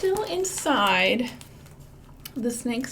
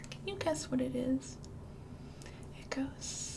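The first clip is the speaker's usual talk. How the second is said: whispering; 6 dB quieter than usual. Real, not acted. Librarian whispering a riddle to children, hushed. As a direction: lean in and whisper the secret.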